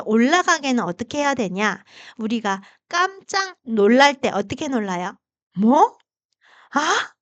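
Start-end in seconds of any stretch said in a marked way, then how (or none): 5.6-7.1 startled 뭐? rising sharply; surprised "what?". Retold or imitated. Teacher illustrating second tone, surprised rising 뭐. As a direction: react like you just heard shocking news. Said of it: "이렇게 놀라잖아요".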